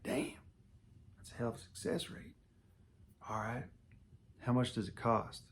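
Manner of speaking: soft, almost whispered amazement; hushed aside. Retold or imitated. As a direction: breathe it out under your breath. Quoted reaction to price.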